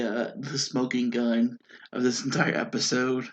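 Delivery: normal talking voice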